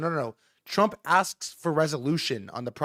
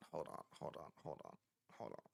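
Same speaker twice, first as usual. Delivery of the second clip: much lower, much softer repeated hold on; muttered; 13 semitones lower, 24 dB quieter than usual. Real, not acted. Talking himself through the news, much softer. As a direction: say it under your breath to yourself.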